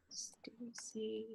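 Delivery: whispered aside to a colleague; a quick whispered call for help. Real, not acted. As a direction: breathe the name with no voice. Whispers co-host's name mid-tech trouble.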